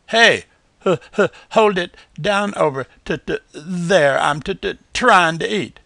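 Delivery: high, loud stammering possum voice; stuttering voice. A narrator's reading. Stuttering unseen voice calling out.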